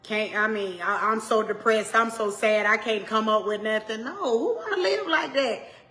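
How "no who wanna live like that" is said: high laughing question. Real, not acted. Preacher laughing off gloomy thinking, high and amused. Laughs at dreary self-talk.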